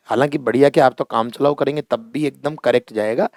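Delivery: ordinary talk, nothing marked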